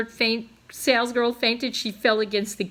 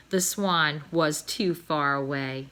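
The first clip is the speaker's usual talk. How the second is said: ordinary delivery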